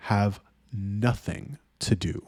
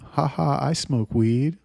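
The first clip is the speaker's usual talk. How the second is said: put-on ditzy girl; 4 semitones higher, slower than usual. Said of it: "They're like".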